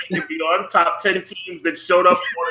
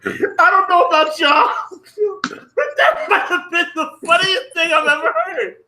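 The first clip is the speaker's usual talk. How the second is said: half shouting through the giggles; laughing; 12 semitones higher than usual. Real, not acted. Still laughing after the joke.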